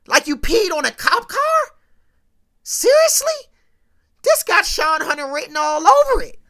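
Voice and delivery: incredulous shout, pitch jumping up on seriously; mock angry dad. TV dad yelling at his son over the cop car, mock outrage. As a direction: yell it in disbelief at the son. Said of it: "He mad".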